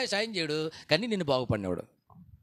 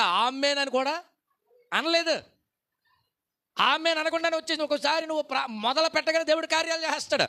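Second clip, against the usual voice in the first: higher, louder revival preaching; fervent preaching; 8 semitones higher, 4 dB louder than usual. Acted. Pastor tells of Elijah's fire to the congregation, fervent preaching. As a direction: drive the story home with fire.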